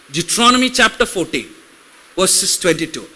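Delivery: quick rattled-off verse reference; fast scripture reference read. Shown as a demonstration. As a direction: rush through the citation fast. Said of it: "Will it help if I go slow or will it help if I go fast?".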